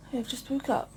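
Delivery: ordinary talk, nothing marked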